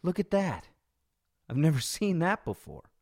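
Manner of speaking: gasping holy shit, high and breathless, then staring in awe; stunned onlooker blurting out what they just saw; shocked disbelief. Retold or imitated. Rider sees woman trapped in donation box, stunned gasp. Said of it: "I was like".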